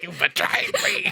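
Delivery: high, hammed-up cry of betrayal; mock betrayal. Joking as boy to his tattoos, mock betrayed. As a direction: wail it as a squeaky joke.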